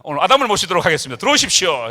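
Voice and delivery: loud, fast emcee voice bringing a guest on; booming host introduction; show host announcement. Acted. Host inviting Adam on stage.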